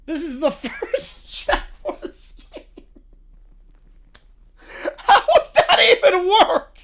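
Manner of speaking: cracking up mid-sentence; helpless high laughter breaking up the words; laughing. Real, not acted. Reading an absurd Jaguars fun fact, losing it laughing.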